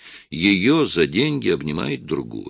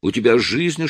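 plain reading voice

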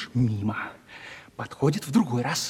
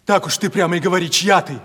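ordinary talk

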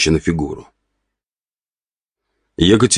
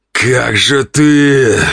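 very slow, drawn-out growl, raised in pitch; exasperated growl; 8 semitones higher, much slower than usual. A narrator's reading. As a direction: barely hold back your irritation. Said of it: "прорычал он, едва сдерживая раздражение".

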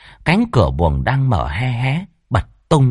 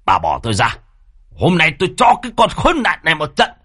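angry husband, quickening into a threat; seething father demanding to be let go; hissed rage; 7 semitones higher, faster than usual. Acted. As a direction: threaten a beating through clenched fury. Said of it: "Ông Tự rít lên".

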